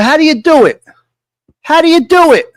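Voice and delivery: hammed-up Trump asking loudly. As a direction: boom it out like Uncle Donald calling. Trump voiced calling on the phone.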